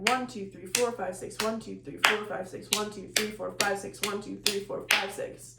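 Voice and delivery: rhythmic counting demo with punched beats; counting a hemiola. Shown as a demonstration. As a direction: count it, hitting the accented beats. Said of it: "So, like, for example".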